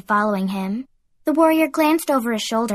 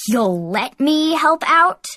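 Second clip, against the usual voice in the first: slow, higher, incredulous retort; indignant snap; 5 semitones higher, 4 dB louder, slower than usual. A narrator's reading. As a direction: throw the words back in disbelief. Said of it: "Heath snapped".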